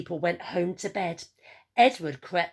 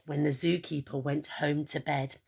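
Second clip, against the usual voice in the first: plain reading voice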